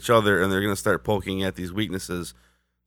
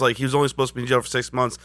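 ordinary talk, nothing marked

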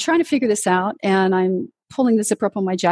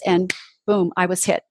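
normal talking voice

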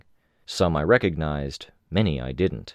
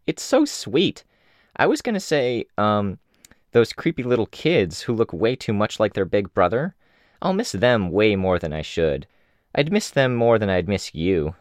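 put-on much higher cheeky girl voice; teasing Imp; 5 semitones higher than usual. Imp teases Taylor about missing her, higher. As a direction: needle her with a grin.

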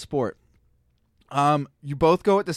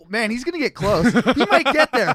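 building, louder and higher; rising excitement; 8 semitones higher, 6 dB louder than usual. Retold or imitated. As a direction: let the excitement climb.

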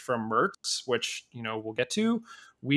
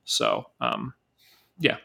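usual speaking voice

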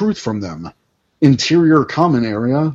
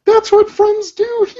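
put-on high girl voice. Voicing teen character.

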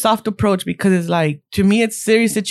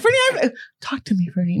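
mock soft approach; 4 dB quieter, faster than usual. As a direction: overdo the tender check-in. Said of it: "The soft approach".

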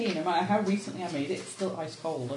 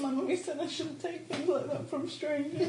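put-on childish voice; 7 semitones higher, 6 dB quieter than usual. Scared character as little child.

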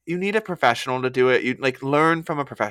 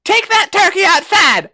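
high, loud mock-furious shout; scolding at full pitch; exasperated yell; 5 dB louder than usual. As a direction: holler the order in comic outrage. Yelling at imagined video people.

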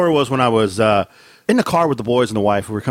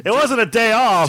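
high, amused objection; laughing protest; 9 semitones higher than usual. Real, not acted. Arguing with co-host about a birthday off, laughing protest. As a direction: protest in a raised pitch through laughter.

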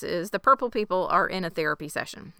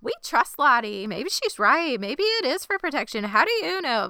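put-on whiny girls; 6 semitones higher than usual. Girls whining at Nat.

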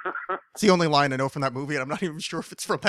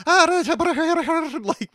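loud, high Irish-accented gibberish; hammed-up leprechaun babble in bad Irish; shitty Irish accent; 13 semitones higher, 5 dB louder than usual. Shown as a demonstration. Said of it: "doing this shitty Irish".

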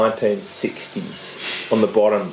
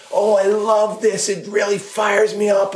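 much higher, gushing fake enthusiasm; overexcited showman, pure cheese; cheesy host voice; 11 semitones higher than usual. Host gushing about his intro in cheesy voice. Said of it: "cheesy, fake bullshit".

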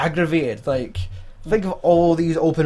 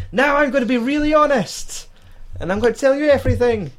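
much higher, hammed-up, blurting faster at the end; mock eager confession; 10 semitones higher than usual. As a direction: gush out a confession like he can't hold it in. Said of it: "And then he's like".

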